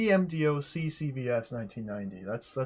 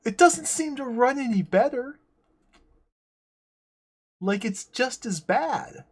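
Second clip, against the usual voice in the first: higher and louder, fed up; exasperated complaint; 11 semitones higher, 8 dB louder than usual. Real, not acted. Player complains that the game still runs badly, higher and louder. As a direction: complain that nothing got better.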